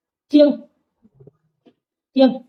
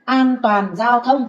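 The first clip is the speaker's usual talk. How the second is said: very slow, every syllable of An toàn giao thông drawn out; slow model reading; much slower than usual. Shown as a demonstration. Teacher slowly models An toàn giao thông for her pupil. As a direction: model it slowly for the student to copy. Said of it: "Em thử đọc xem nào".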